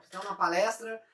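normal talking voice

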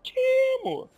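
squeaky high voice saying Timo; high-pitched Timo. Shown as a demonstration. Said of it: "qual o melhor champion".

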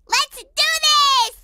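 high, drawn-out eager cheer; rally the troops, stretching each word; gung-ho rally. Acted. Girl rallying little hamsters, eager drawn-out cheer.

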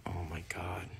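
breathy under-the-breath whisper; hushed exasperated "oh my God"; whispered aside. Acted. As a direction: mutter it to yourself in disbelief. Sibling reacts under breath.

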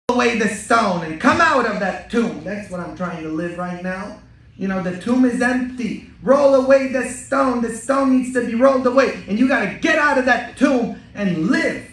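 high, loud, rousing Easter preaching; fiery preaching. Acted. Priest urging his Easter congregation, in loud fiery preaching. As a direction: lift the voice and urge them out of the tomb.

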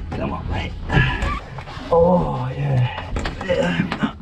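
ordinary delivery

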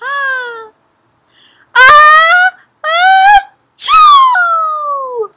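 huge high build-up into a loud achoo; hammed-up storybook sneeze, long and loud; pretend sneeze. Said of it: "He blows and he sneezes".

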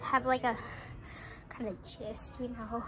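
low, very soft, hesitant voice. Acted. Homeless neighbour asking to stay, shy and hushed. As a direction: mumble it timidly from across the street. Sorry I talk very slow.

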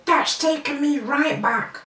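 eating a biscuit; talking while chewing. Real, not acted. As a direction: mouth partly full. Seen 0: Bites biscuit at -4 and 1.7, holds it at mouth throughout.

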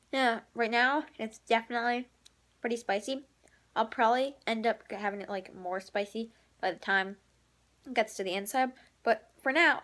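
chewing while talking; slight tongue movement from eating; mouth with candy. Real, not acted. Seen 2: Puts candy pieces in mouth at -3, 1.7, 14.6; red mouth later.

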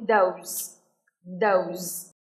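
"Those" low, ending on a clear Z; low-pitched "Those", closing on Z; final Z. Shown as a demonstration. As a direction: model "Those" twice with a buzzed ending. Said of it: "Có âm Z ở phía cuối".

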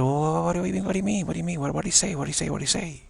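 high-pitched, urgent barrage of questions; eager questioning. Acted. Family pressing a returning concertgoer for answers, eager questioning.

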